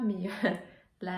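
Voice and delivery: usual speaking voice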